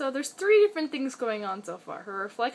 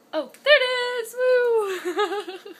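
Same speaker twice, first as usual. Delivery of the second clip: higher, louder delighted cry; excited cheer; 8 semitones higher, 9 dB louder, much faster than usual. Real, not acted. Owner cheering as the cat finds her mouse, excited cry.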